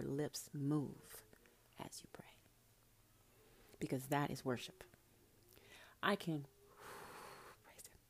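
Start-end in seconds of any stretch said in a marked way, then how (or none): 6.0-8.0 fading to a near-silent, emotional whisper; real tearful hush; choked up. Real, not acted. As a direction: voice failing as emotion takes over. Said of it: "I got a little emotional".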